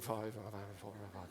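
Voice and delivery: imitating the crowd mumbling the numbers under their breath. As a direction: mumble like an unsure audience. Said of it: "people mumbling".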